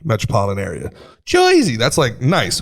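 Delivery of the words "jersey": Jersey sung up, much higher and bright; cheery Jersey. Shown as a demonstration. Demoing the too-nice way to say Jersey. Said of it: "you can't smile and speak".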